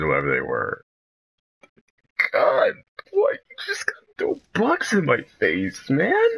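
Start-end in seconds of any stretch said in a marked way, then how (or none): 2.3-6.3 whimpering. Real, not acted. Another bug flies at face.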